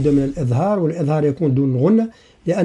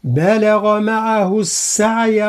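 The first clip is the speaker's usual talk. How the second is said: slow, higher Quranic chant; Quran recitation; 4 semitones higher, much slower than usual. Shown as a demonstration. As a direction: recite the verse with full tajweed. Recites the verse. (تلاوة مجودة بطيئة)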